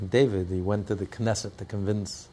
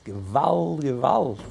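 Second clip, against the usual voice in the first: higher, mildly raised outcry; retold shout; 5 semitones higher, 3 dB louder, slower than usual. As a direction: imitate his cry with raised pitch. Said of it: "he's still shouting".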